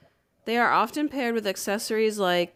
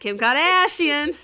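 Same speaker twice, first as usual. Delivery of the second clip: slow, loud and higher drawn-out name; mock Kardashian drawl; 6 semitones higher, 6 dB louder, slower than usual. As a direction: echo it back, slow and hammed up. Echoes the same mocking name.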